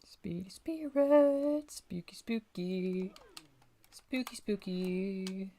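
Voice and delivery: slow, long-drawn eerie chant; spooky ghost call. Pretend. Player calling spooky spirits in the game, ghostly chanting.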